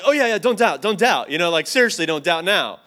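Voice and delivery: loud, high, frantic self-urging; hammed-up scramble to talk himself out of doubt; mock panic. Comic frantic self-urging after scare.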